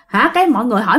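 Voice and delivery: plain reading voice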